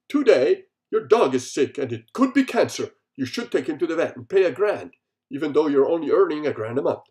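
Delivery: loud, hammed-up headline read; mock news announcer. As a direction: announce the bad news like a TV anchor.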